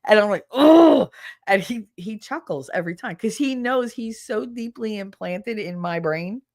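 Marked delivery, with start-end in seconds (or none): 0.5-1.1 sudden high loud exclamation; a real startled yelp of recognition; surprised oh. Real, not acted. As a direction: yelp it, caught out. Caught by the song trigger.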